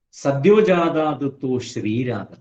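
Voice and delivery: slow, chanted Sanskrit verse; measured scriptural chant; sloka recitation. Shown as a demonstration. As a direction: intone it like scripture.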